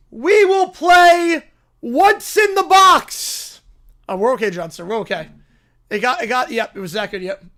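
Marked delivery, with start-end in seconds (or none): 0.1-3.6 high, loud, drawn-out proclamation; shouted announcement. Acted. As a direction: announce it like a grand decree. Acted character announces a game.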